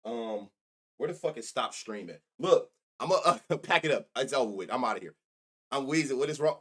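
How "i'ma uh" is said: ordinary talk, nothing marked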